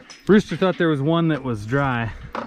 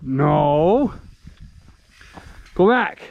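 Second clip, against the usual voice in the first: shouting at cattle; 3 semitones higher, much slower than usual. Real, not acted. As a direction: shout them back. Shooing cattle back.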